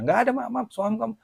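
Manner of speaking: tiruan penagih ngotot, nada tinggi; penagih memaki. Retold or imitated. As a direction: memotong permintaan maaf dengan nada tinggi. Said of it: "saya dimaki-maki". (menirukan penagih utang yang kesal)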